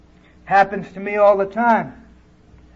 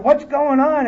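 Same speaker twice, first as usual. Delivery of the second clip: higher-pitched startled mother's question; alarmed demand; 7 semitones higher than usual. Retold or imitated. Mother at door, kids spitting.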